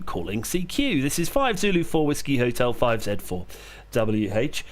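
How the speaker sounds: steady, clipped radio call sign readout; radio call procedure. Real, not acted. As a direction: call it out like a ham operator. Calling CQ on ham radio.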